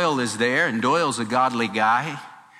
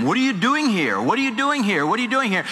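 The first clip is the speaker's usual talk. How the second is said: much higher, pressing the same question three times; insistent divine questioning; 8 semitones higher, faster than usual. Retold or imitated. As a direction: keep pushing the question, higher each time. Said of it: "God kept convicting him".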